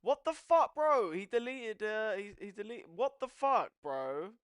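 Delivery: high, loud, exasperated; can't believe he deleted it; outraged disbelief. Real, not acted. Yelling at the chat about Zerka, high loud outrage.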